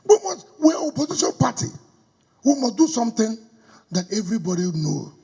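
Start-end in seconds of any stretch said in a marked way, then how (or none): none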